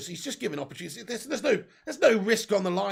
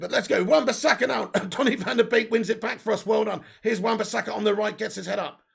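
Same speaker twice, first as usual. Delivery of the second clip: ordinary talk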